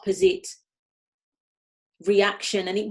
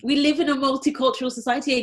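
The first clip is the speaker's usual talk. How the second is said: ordinary talk, nothing marked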